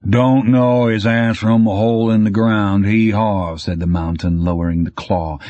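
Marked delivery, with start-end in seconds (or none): 0.1-3.6 mocking imitation of Rydell's voice; mocking parody of Rydell. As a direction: parrot his voice back to mock him. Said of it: "its voice a sampled parody of Rydell's".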